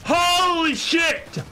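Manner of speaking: high, loud, slow holy shit; shocked yell. Real, not acted. Gamer yelling at the blinding effect, shocked. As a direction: drag the curse out in shock.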